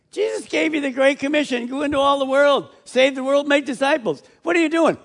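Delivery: rebuking angel. Acted. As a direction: scold them for standing idle.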